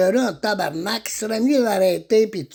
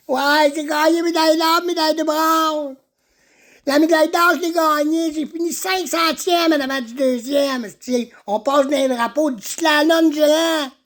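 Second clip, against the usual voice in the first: high-pitched boastful son character; Jérémy; 8 semitones higher than usual. Acted. Farmer's son boasts of his Olympic medals, high and proud.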